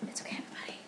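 breathy whispered reassurance. Acted. Host whispers to the audience to reassure them. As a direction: whisper it to calm the crowd.

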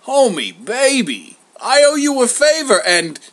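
high, loud schmoozing pitch; butter up an old pal, showily; brash, over-familiar sweet talk; wheedling Zeus. Acted. Zeus sweet-talks Homer.

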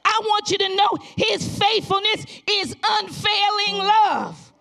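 loud, rising revival preaching; preaching at full force. Acted. Pastor exhorting the congregation, loud full-force preaching.

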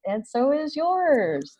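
high and slow, pointed retort; a mock deadpan reply to the lawyer; wry comeback. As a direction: hand the compliment back with dry irony. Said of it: "And I'm like".

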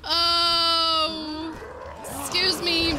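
high, drawn-out startled wail; panicked cry. Real, not acted. Player attacked in hallway, panicked cry. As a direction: cry out in alarm.

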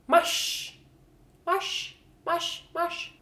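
"Mars" as mush, ending in sh; Swedish pronunciation. Shown as a demonstration. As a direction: say Mars high and short with rs turned to sh. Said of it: "how it's pronounced".

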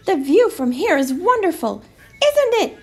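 delighted storybook mouse. A narrator's reading. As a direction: gush over the view as a little mouse. Mouse admires the view.

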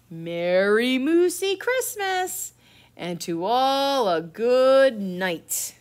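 slow, rising, drawn-out holiday cheer; calling out. Acted. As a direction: proclaim it slowly and loudly, stretching each word. Story's closing cry, called out.

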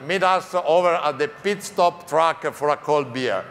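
ordinary talk, nothing marked

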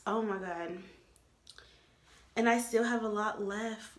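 ordinary talk, nothing marked

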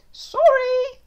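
high loud apologetic sorry. Acted. Dad apologizing after a fart, high. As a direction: chirp out sorry.

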